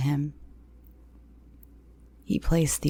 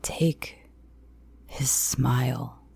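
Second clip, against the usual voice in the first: menacing whisper; 2 semitones lower, 5 dB quieter, much slower than usual. Acted. Man's chilling order.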